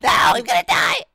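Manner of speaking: high desperate shout; panicked scream. Real, not acted. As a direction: scream as you're about to lose. Dying in game, shout.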